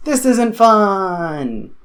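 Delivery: mock whine. First take, higher and slowed.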